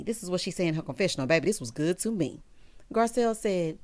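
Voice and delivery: ordinary talk, nothing marked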